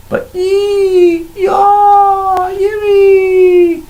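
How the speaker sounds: high, loud yelping creature cries; shrill little monster yelps; kobold shrieks. Acted. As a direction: let out tiny panicked kobold screams.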